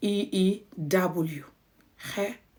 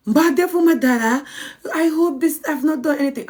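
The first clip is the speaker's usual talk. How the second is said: innocent act; 7 semitones higher than usual. Retold or imitated. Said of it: "So they might be like".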